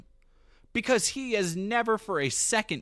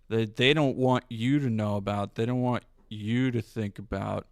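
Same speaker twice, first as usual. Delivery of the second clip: normal talking voice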